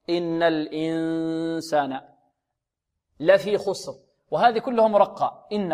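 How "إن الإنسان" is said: الإنسان slow, noon hidden in the nose; nasal ikhfa. Shown as a demonstration. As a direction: hum الإنسان nasally and slowly. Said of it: "نضغط على الأنف". (إخفاء صحيح بالغنة)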